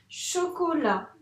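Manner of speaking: chocolat, syllabes étirées; relecture lente. Shown as a demonstration. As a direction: relis le mot doucement, son par son. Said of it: "j'essaie de me relire". (maîtresse qui relit lentement le mot)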